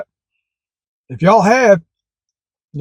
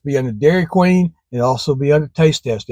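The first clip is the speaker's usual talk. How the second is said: ordinary talk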